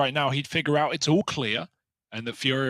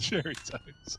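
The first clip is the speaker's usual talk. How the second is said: breathy whisper; 9 dB quieter than usual. Pretend. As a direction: whisper Cheerios back. Whispered echo of joke.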